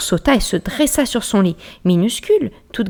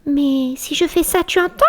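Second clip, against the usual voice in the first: whispering mouse; 9 semitones higher than usual. Acted. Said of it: "chuchota-t-elle".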